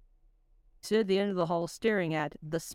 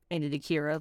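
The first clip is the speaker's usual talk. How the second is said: ordinary delivery, nothing marked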